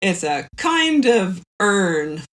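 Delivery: ordinary talk, nothing marked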